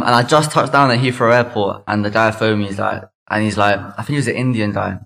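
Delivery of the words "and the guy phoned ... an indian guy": normal talking voice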